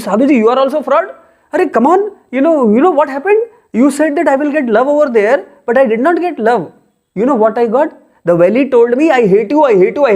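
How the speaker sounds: high, fast, aggrieved protest; indignant complaint. Acted. Man accusing the sadhu of tricking him, fast and indignant. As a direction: complain to him as if he cheated you.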